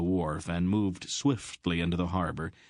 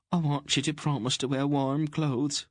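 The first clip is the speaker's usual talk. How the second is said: higher, fussing queen voice; worried plump queen pleading; fretful little queen; 8 semitones higher than usual. A narrator's reading. Queen begging Aunt Paul to keep the king safe, fretful.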